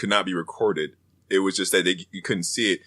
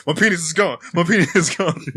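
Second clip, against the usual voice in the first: freaking out; 5 semitones higher than usual. Retold or imitated. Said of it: "he's like freaking out".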